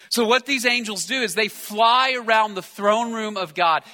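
ordinary talk, nothing marked

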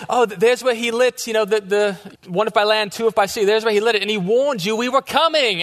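high British mom giving a tour; British accent. Retold or imitated. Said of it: "with a British accent".

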